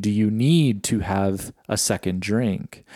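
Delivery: slowed down, with need punched out; gentle mock of Sarah's pointed question; stress on need. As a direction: lean on need to make the question a jab. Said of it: "emphasis on the word need".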